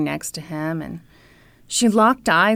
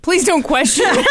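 laughing plea; 8 semitones higher, 3 dB louder than usual. Real, not acted. Cracking up while being teased.

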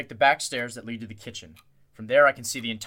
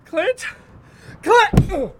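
high loud yell; shouting; 10 dB louder than usual. Acted. Still searching the fog, yelling for Clint. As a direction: yell out his name.

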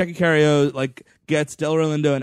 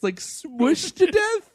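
ordinary talk, nothing marked

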